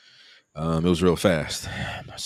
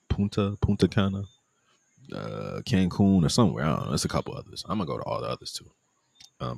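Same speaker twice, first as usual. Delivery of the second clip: ordinary talk, nothing marked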